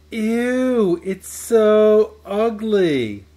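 loud, high, drawn-out "ew, so ugly"; mock the painting with disgust; loud disgusted mean-girl jeer; disgusted sneer. A narrator's reading.

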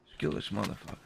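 low, hushed plotting voice; conspiratorial undertone. Pretend. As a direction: quietly plot a kill, voice dropped low. Plotting a kill in secret.

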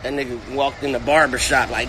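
ordinary delivery

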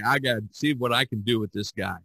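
ordinary talk

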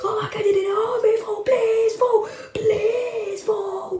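high, hammed-up begging for help; mock panicked plea. Prankster fakes a robber call to Paul, mock panicked. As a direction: plead frantically, as if faking an emergency.

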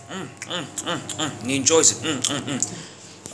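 soft satisfied mm sounds of chewing; mock eating hum. Ababa eating corn, quiet pleased mm hums. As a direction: hum like a man enjoying his food.

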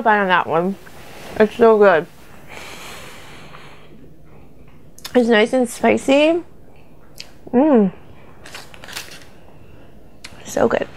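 chewing while talking; muffled by food in mouth; mouth full. Real, not acted. Seen 0: Closed-lip chewing and full cheeks after burger bite, speaking between chews.

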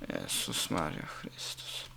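breathless slow whispered gasp; shocked whisper. Real, not acted. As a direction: whisper it in disbelief. Near crash on winding road.

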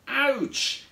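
high, mild yelp of pain; storybook ouch; pained cry. A narrator's reading. Pierre when his friend lands on him, pained cry. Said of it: "cried Pierre".

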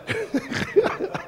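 short, high, breathy chuckle; high laugh. Real, not acted. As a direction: laugh briefly at the heated scene. Laughs at heated exchange.

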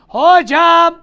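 loud high call to the teacher. Acted. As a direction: yell for the teacher's attention. Student calls out to teacher. (yüksek sesle seslenme)

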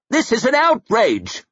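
high-pitched cry of protest; scandalised lord calling out; outraged shout. A narrator's reading. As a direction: shout your indignation. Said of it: "shouted another man".

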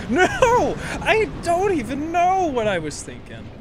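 high, loud cry easing off; exasperated outcry. Real, not acted. Streamer after a blunder in the game, exasperated outcry. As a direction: burst out in dismay at yourself. Upset at own game mistake.

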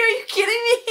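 very high, incredulous mock outburst; laughing disbelief. Photographer to the laughing teacher, amused disbelief.

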